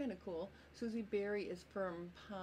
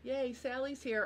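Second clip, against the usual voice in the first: bright, high cheer for a friend; excited; 7 semitones higher, 4 dB louder than usual. Real, not acted. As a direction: greet her with a happy lift. Greeting a viewer who arrived.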